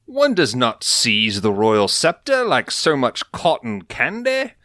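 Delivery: put-on pompous posh English accent, rising high at the end; haughty British royal voice; English accent, lofty and affected. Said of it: "So you do the English accent".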